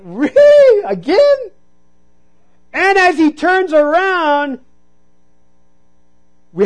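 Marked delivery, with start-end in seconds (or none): 0.0-1.4 high, incredulous disbelief; mock exasperation at the driver; exasperated really. Preacher imitating his own exasperation at the U-turning driver, incredulous. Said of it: "I'm like".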